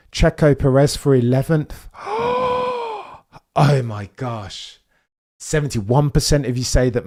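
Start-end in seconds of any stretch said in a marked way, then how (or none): 1.9-3.0 a high, sudden intake of breath; sharp shocked gasp. Real, not acted. Reacting to the race gaps with a shocked gasp. 3.5-4.8 stunned disbelief. Real, not acted. Stunned after the gasp.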